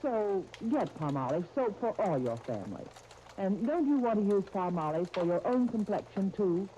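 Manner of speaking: ordinary talk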